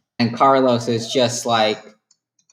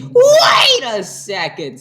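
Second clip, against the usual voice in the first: higher, louder, slow laughing shout; mock royal outburst; hammed-up shout; 10 semitones higher, 5 dB louder, much slower than usual. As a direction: bellow it like a dramatic king. King Carlos voiced.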